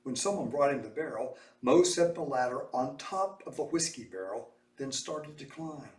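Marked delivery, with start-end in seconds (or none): none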